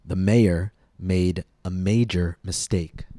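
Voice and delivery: slow, carefully separated words; careful model sentence. Shown as a demonstration. Teacher models J and Y for learners, slow and careful. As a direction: model it slowly for learners to repeat. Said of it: "paying careful attention to the differences".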